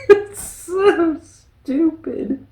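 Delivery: slow, high, laughing through the words. Real, not acted. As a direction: giggle it out in delight. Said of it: "laughing at the movie".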